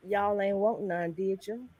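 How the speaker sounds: soft, slow mock singer impression. Teasing the other moms about drinks, lightly put-on singer's voice. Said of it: "in my [name] voice".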